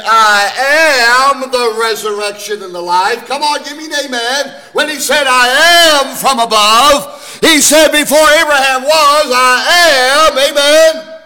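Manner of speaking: high, loud, ringing proclamation; preaching at full force. Acted. Preacher calling out Jesus' words, high and loud. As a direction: lift the church with each I am.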